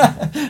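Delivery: high, loud burst of laughter. Real, not acted. Laughing at the burger story.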